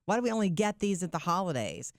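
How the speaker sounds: ordinary talk, nothing marked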